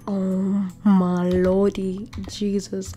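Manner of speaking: slow, drawn-out exclamation; overwhelmed. Real, not acted. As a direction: stretch each word in awe. Overwhelmed by finding more gold.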